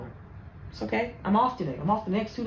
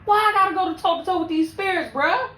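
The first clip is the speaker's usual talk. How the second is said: exasperated outcry; 7 semitones higher than usual. Real, not acted.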